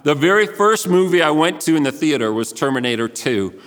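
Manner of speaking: ordinary talk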